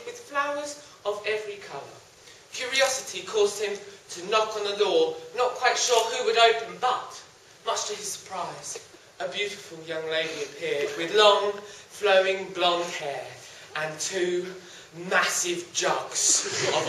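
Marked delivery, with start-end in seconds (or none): none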